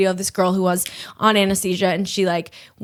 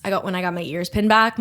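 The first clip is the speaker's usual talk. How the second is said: ordinary talk, nothing marked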